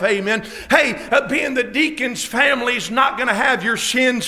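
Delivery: preaching at full force. Acted. Preacher urges students to be saved, shouting. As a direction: drive the altar call home at full force.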